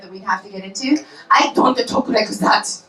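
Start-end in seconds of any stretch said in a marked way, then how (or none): 1.3-2.8 put-on slow, indignant Japanese-accented protest; the offended Japanese mom denying her accent; mom's Japanese accent. Comedian plays her mom protesting in a Japanese accent. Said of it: "talking about her accent".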